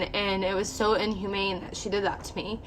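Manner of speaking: ordinary delivery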